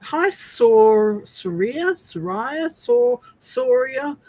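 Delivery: "Soria" tried slowly, syllable by syllable; struggling with a name. Real, not acted. Host greeting viewer, stumbling over "Soria". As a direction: fumble over "Soria" and retry. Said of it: "I'll mangle your name".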